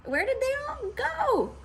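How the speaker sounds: high shout; witch shouting. A narrator's reading. Grinch shouts at the duck, high. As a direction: call out to the duck. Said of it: "Grinch shouted to a duck".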